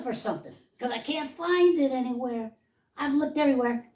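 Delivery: high, excited cartoon-kid voice; little made-up voice. Showing off his childhood voice for the audience, little made-up voice. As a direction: put on a silly, high-pitched character voice. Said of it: "So I had a voice like this".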